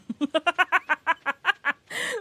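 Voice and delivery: loud, high run of laughter; laughing fit. Real, not acted. Laughing at her own failed impression, loud laugh. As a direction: laugh helplessly.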